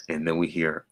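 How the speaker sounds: ordinary talk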